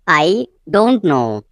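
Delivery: "I", a pause, then slow "don't know"; slow teaching take with "I" set apart; slow repeat. Shown as a demonstration. Teacher repeating the translation for learners, slowly.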